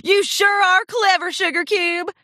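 lightly voiced hearty friend; admiring cowgirl pony. A narrator's reading. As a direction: praise her with hearty country warmth. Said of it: "said Applejack".

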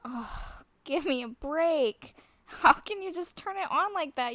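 loud, rising disbelief; can't believe what she hears; incredulous. Real, not acted. Reacting to friend's sexy voice.

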